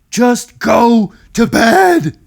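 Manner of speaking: slow, loud drawn-out order; dad behind the newspaper ordering bed; exasperated dad. Acted. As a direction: drag it out like a worn-out father. Tired dad in story.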